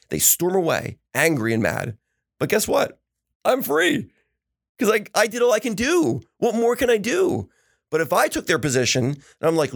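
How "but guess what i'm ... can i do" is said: excited. Real, not acted. To his co-host about forgiveness, exultant and high. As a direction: pitch jumps way up, triumphant and freed. Preaching that apologizing frees him.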